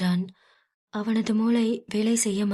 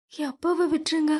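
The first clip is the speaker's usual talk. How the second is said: put-on higher young woman pleading; pleading daughter; 7 semitones higher, faster than usual. Meera pleading with Aadhi for her father, higher voice. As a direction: beg him to spare her father.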